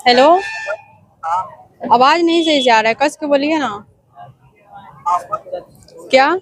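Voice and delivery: put-on man putting on a girl's voice on a call. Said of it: "लड़की की आवाज में बात करेंगे".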